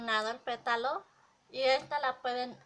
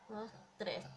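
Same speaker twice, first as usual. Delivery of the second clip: quiet counting; 11 dB quieter than usual. Real, not acted. Embroidery teacher counting her own thread wraps, soft murmur. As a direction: count the wraps under her breath, much softer.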